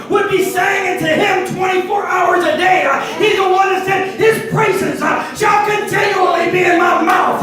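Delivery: preacher driving the church toward God's love; full-force preaching. Acted. Preacher rousing the congregation, preaching at full force.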